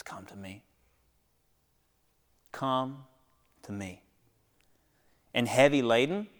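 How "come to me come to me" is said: soft hushed invitation, much softer. Acted. Preacher voicing Jesus's call to the weary, hushed. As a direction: draw them in with a near-whisper.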